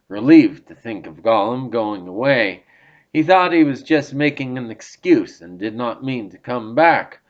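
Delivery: ordinary delivery, nothing marked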